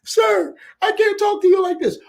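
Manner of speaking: high-pitched put-on woman's voice; high mock lady voice. Telemarketer lady begging the caller, squeaky high voice. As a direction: squeak it out like a flustered call-center lady.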